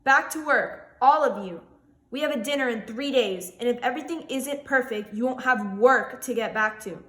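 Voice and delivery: loud, angry shout at the staff; shouted order. Acted. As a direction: bark the staff back to work. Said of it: "he shouted back".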